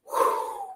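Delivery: airy, voiceless whoosh; soft "whew" of blown air; imitated breath blown out. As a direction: blow out like a gust of breath. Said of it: "and he went".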